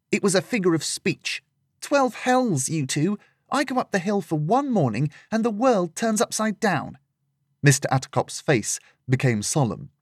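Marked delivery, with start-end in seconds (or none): 0.1-7.0 put-on much higher girl's voice, fed up; young Lillian, exasperated. Lillian exasperated with the two adults, high girlish voice. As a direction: throw up your hands vocally, pitched up.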